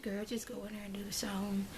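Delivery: hushed brush-off; a quiet dismissive aside; dismissive whisper. Retold or imitated. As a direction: whisper it like shooing her away. Husband brushing her off quietly.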